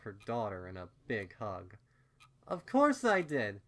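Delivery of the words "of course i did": higher, louder playful cooing; cooing mother. A narrator's reading. Mother scooping up her daughter, cooing playfully. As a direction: coo it to your little one. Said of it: "she cooed".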